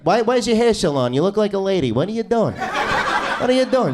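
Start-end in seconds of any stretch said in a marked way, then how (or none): 2.6-3.9 drawn out, higher, mock-baffled; slow incredulous repeat. Comic to audience member, slow mock-baffled repeat. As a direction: stretch it out in disbelief.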